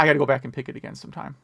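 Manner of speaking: ordinary delivery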